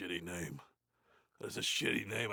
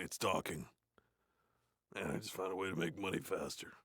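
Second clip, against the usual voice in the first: ordinary talk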